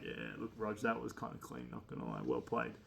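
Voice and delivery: softly murmured, a hint of secrecy; hushed praise from the back row. Boys at the back quietly praising Rog, hushed. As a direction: keep it low so the teacher can't hear.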